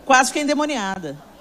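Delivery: ordinary talk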